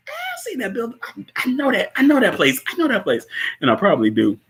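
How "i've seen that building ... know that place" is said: high-pitched mock realization; excited recognition. Telling viewers the building looks familiar, high excited recognition. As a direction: squeal it as if spotting somewhere familiar.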